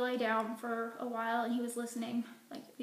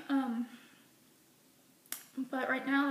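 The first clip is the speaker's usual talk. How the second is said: normal talking voice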